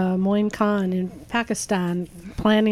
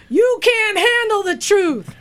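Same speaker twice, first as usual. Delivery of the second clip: movie quote voice; 9 semitones higher, slower than usual. Pretend. Movie quote intro gag.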